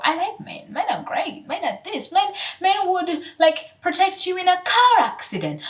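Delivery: high, gushing mimicry of her old self; high airy mock praise; mock naive voice. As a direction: gush about men like her naive past self. Quoting her former praise of men.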